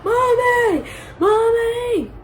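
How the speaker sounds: high mock wail for mom; retold crying call. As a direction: imitate a crying child calling out. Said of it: "Like, I'm crying".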